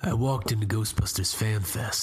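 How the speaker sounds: low, mock-serious storyteller narration; storyteller impression. Host narrates the fan fest visit to co-hosts as a hammed-up storyteller. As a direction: tell it like a gritty narrator. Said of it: "You're doing your storyteller, like, impression".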